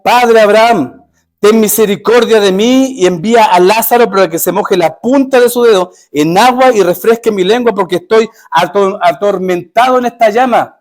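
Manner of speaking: crying out. Acted. Rich man crying out to Father Abraham, pleading loudly. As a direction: call out from the flames, begging. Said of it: "dando voces".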